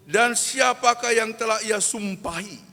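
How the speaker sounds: loud, high verse proclamation; full-force preaching. Acted. Preacher moves on to the next verse, full-force preaching. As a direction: ring the verse out to the congregation.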